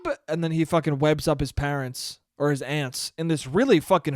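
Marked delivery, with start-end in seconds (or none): 0.1-0.2 very high loud cartoon voice; spider calling for web. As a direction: squeak it like a tiny bug hero. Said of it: "he's like".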